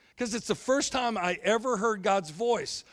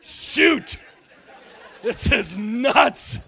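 loud, higher, laughing outrage; indignant Peter; 6 semitones higher, 9 dB louder than usual. Retold or imitated. Peter after Jesus calls him Satan, loud laughing indignation. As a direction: play a wounded Peter, incredulous. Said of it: "It's like".

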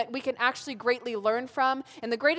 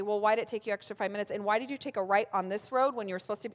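rapid nagging questions; 3 dB quieter, faster than usual. Retold or imitated. Mom interrogates kids via tracking app.

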